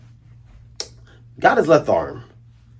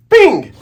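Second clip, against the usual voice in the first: slingshot ping; 12 semitones higher, 4 dB louder than usual. Retold or imitated. Slingshot sound effect.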